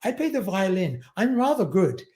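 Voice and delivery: mock-posh British boy introducing himself; posh English accent. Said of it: "he spoke like that".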